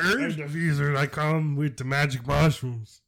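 strut in with it like a cocky wizard; swaggering wizard. Pretend. Host plays the swaggering wizard peddling mushrooms, hammed up.

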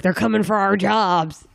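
hammed-up alarm, drawn out slowly; mock freak-out. Host mimicking the shocked taxi driver, mock freak-out. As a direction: stretch it out in an exaggerated imitation of panic. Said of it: "He's, like, freaking out".

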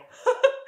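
high nervous giggle; an anxious burst of laughter. Retold or imitated. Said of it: "I laugh nervously".

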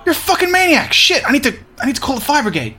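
high-pitched frantic alarm; panicked. Acted. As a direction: blurt it out in a flustered panic. Panics, man on fire.

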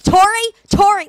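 high, loud urgent name-calling; mock emergency panic. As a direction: imitate mom calling in an emergency. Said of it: "it was like an emer".

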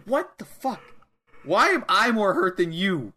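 high-pitched, incredulous outrage; mock indignant disbelief. Wrestler hurt by her own chop, mock indignant. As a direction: imitate a baffled, hurt fighter.

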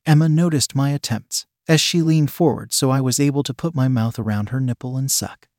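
usual speaking voice

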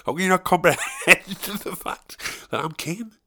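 high protest broken by laughter; incredulous child self. Retold or imitated. Boy pleading with mum about being Kane, laughing high protest. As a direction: laugh through a mock-indignant plea, rising at the end. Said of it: "I just kept going".

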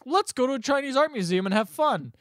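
lightly voiced, high-pitched dog; talking dog. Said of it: "the dog's like".

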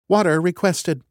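a ship computer confirming the order. Acted. The computer answers.

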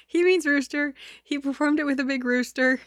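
trying to explain while cracking up; giggling aside to listeners; laughing. Real, not acted. Reader cracks up at word.

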